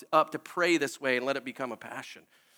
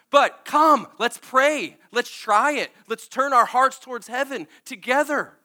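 loud, high preaching, driving the call home; fervent preaching; 6 semitones higher, 6 dB louder than usual. Acted. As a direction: urge them to come, full force. Impassioned altar call.

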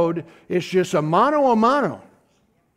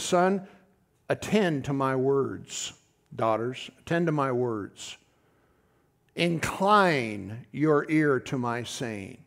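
tender scripture reading; 6 semitones lower, 4 dB quieter, slower than usual. Shown as a demonstration. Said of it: "The Bible says".

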